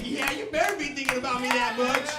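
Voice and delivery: put-on high, loud girlfriend voice; mock girlfriend. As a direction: play the demanding girlfriend. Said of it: "She's like".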